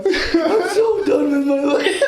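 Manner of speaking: high, drawn-out mock wail breaking into laughter; hammed-up cartoon despair, laughing; [name]. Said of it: "I could do two conversations as well".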